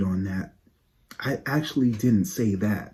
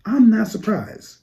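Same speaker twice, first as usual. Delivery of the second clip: much higher, slow smug drawl; 11 semitones higher, 5 dB louder than usual. Real, not acted. Vlogger gloating at fans, high slow smug tone. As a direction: savor being right.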